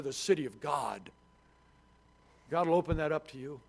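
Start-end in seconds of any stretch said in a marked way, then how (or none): none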